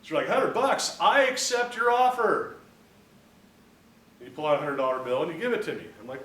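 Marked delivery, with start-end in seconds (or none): 0.3-2.6 loud, high, slowing declaration; eager acceptance. Acted. Buyer snapping up a hundred-dollar boat, acted excitement. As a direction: jump on the bargain with a big ringing voice.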